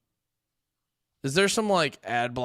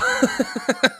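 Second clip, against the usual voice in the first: sudden high laugh bursting out; burst of laughter; 13 semitones higher, 4 dB louder than usual. Real, not acted. Streamer reading chat news bursts out laughing. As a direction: crack up at the absurd headline.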